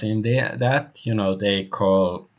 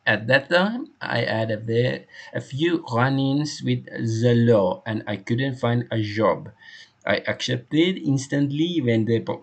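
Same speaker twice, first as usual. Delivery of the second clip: put-on animated French accent, slightly raised; French-accented loyal servant; 4 semitones higher than usual. Hector telling the inspector how he was hired, French-accented. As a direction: play the devoted French employee giving testimony.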